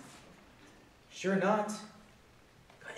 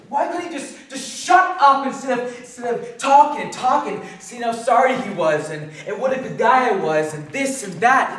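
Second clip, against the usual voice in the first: loud, higher-pitched, agitated complaint; exasperated rant; 3 semitones higher, 8 dB louder than usual. Acted. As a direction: build up steam against the boss. Rants about the boss talking.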